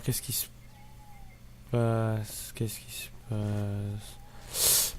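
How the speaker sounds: very slow, stretched-out question; drawn-out wondering. Real, not acted. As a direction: let each word trail out long and soft. Puzzling aloud while waiting in game.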